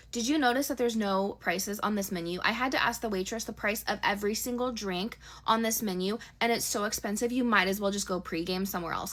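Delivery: ordinary talk, nothing marked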